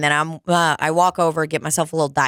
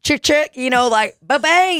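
high punchy ba-bang with a click; finger-gun sound effect; 8 semitones higher than usual. Retold or imitated. Manager nailing her joke to the couple, punchy sound effect.

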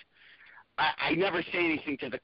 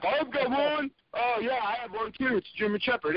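eager audience member; 11 semitones higher than usual. Retold or imitated. Audience members answering comic.

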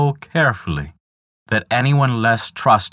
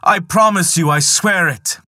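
desperate promise; 9 semitones higher than usual. Acted. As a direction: swear anything to stop the pain. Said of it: "implored Loki".

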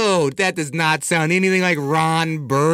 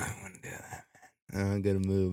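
whispered low aside; mock-secretive murmur; hushed warning; 7 semitones lower, 12 dB quieter than usual. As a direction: mutter it very softly under your breath.

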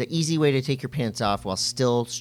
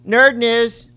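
announcer voice; 9 semitones higher, 6 dB louder than usual. Pretend. Repeats the segment title.

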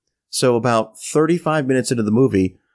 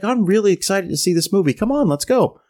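imitating her higher, eager chatter; excited urging; 7 semitones higher than usual. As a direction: hurry them along brightly, as her. She is eager for movie.

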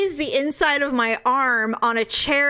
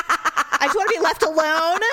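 mad; 5 semitones higher than usual. Retold or imitated. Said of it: "'cause now I'm mad".

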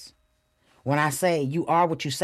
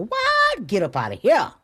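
excited; 10 semitones higher, 5 dB louder than usual. Real, not acted. Excited self-exclamation.

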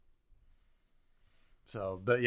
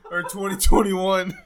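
laughing; 7 semitones higher, 6 dB louder than usual. Real, not acted. Laughing hard about video.

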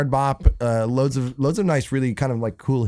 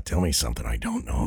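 deep, low singer impression; 11 semitones lower, 5 dB quieter, faster than usual. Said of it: "That's a very good impression".

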